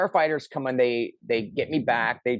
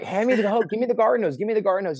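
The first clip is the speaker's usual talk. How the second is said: high, louder insistent demand; retold frantic shout; 10 semitones higher, 3 dB louder, faster than usual. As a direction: yell for the hose like the roof's burning. Demanding hose during fire.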